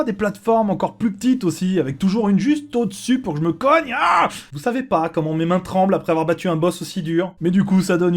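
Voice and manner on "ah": startled yelp. Real, not acted. Hits ceiling during escape.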